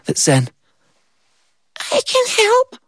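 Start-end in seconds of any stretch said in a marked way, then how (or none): 1.9-2.8 very high tiny voice; tiny ant voice. Acted. Tiny ant offering help, very high squeak. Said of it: "this tiny little voice".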